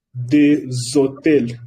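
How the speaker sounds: Des hôtels very slow, the s linked as z; slow demo of a liaison with h. Shown as a demonstration. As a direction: drawl Des hôtels with a clear z link. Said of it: "S se pronuncia z".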